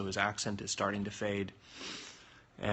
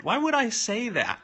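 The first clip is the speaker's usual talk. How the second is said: incredulous; 13 semitones higher, 7 dB louder than usual. Real, not acted. Reading a cheeky question.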